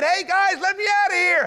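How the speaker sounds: high, loud yell for help; imitated scream. Joseph calling to his brothers from the pit, mock screaming. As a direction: holler up from the bottom of a pit. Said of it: "He's in there screaming in the pit".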